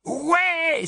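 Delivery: very high loud cheer; enthusiastic. A narrator's reading. Boy cheering his father's idea, high loud yes. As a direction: cheer it eagerly. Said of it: "avec enthousiasme".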